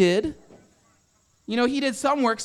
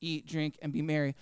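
low, soft self-talk; 8 semitones lower, 9 dB quieter than usual. Acted. Rich man reassuring himself, low self-talk. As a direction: drop it low and quiet, as if to yourself. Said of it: "I'll say to myself".

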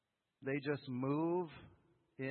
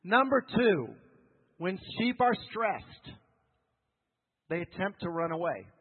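preaching at full force; 3 semitones higher, 9 dB louder than usual. Acted. Back to loud preaching.

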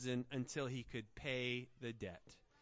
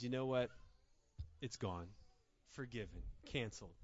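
softer, lower, tender release of debt; gentle forgiving master; 6 semitones lower, 8 dB quieter, faster than usual. Acted. Master forgiving the servant's debt, quiet and tender. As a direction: let it go softly, like a gift.

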